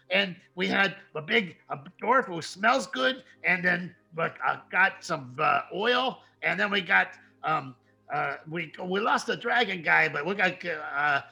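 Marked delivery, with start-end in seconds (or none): none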